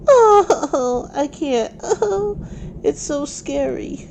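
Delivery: put-on high loud tearful baby voice; crying baby voice. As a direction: sob it out like a scared toddler. Baby crying over the storm.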